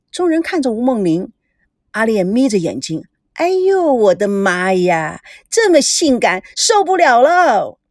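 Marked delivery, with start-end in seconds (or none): 3.3-7.8 drawn-out, lecherous gushing; hammed-up swooning admirer. Crew member ogling a woman in nightwear, swooning. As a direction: moan in exaggerated admiration, stretching the words.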